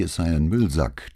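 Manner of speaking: ordinary delivery, nothing marked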